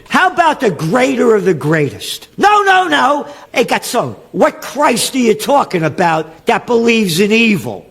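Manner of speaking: high, loud railing at the clergy; ranting shout. Real, not acted. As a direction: hammer them with each question. Ranting at Catholics and priests.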